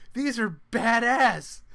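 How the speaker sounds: loud, slow and amazed; excited. Retold or imitated. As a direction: stretch it out in awe. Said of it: "And I was like".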